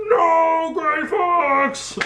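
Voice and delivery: high, strained mock wail of grief; hammed-up anguished cry. Snake's cry as friend dies.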